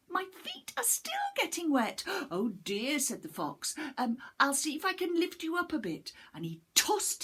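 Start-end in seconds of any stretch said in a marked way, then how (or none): 0.1-3.0 high, squeaky, fast panicked little voice; a tiny cookie in a flap; high panicky little gingerbread man; frightened gingerbread man. Acted. 4.0-6.1 brisk false helpfulness; hint of scheming fox; sly fox, quick and higher. Fox pretends to help.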